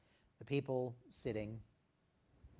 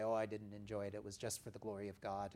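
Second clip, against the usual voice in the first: quick, softer falsely humble brush-off; false modesty; 4 semitones lower, 8 dB quieter, faster than usual. Retold or imitated. Believer brushing off a compliment, falsely humble. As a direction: wave away the praise with fake humility. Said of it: "We don't need to say".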